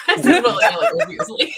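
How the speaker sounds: high-pitched laughing reply. Real, not acted. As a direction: laugh through the admission. Laughing admission.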